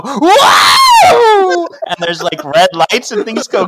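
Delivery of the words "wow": high, very loud drawn-out mock wail; long dramatic scream. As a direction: cut off into a huge theatrical scream. Reading cut off by magical blast.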